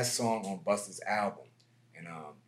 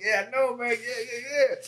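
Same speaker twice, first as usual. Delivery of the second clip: cocky excitement; 14 semitones higher, 5 dB louder than usual. Retold or imitated. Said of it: "I'm like".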